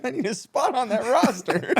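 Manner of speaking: high, slow pleading player voice; begging athlete. Pretend. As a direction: beg like a washed-up player. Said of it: "Listen, man".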